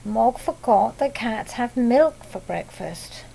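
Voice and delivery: ordinary delivery, nothing marked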